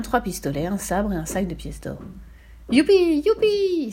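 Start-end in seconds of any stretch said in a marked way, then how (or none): 2.7-3.9 much higher, louder whoops of delight; joyful cry. A narrator's reading. Fifi finding gold coins in the chest, joyful whoops. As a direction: cheer like finding treasure. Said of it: "s'écria-t-elle".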